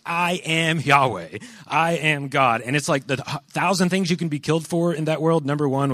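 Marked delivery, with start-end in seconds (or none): 0.0-2.6 higher, louder, slow declaration; bold claim. Retold or imitated. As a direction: declare I am God slowly and boldly. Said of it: "he's like".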